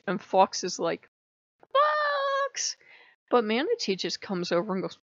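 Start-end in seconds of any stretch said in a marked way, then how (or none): 1.7-2.8 squeaky, very high cry of its own name; very high fox shout. Retold or imitated. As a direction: chirp the name to grab center stage. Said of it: "think they need to take center stage".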